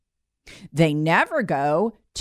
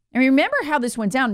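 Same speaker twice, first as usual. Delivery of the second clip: usual speaking voice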